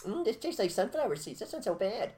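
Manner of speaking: high mocking voice of a bug-eater. Mocking a person who eats bugs, high pitched.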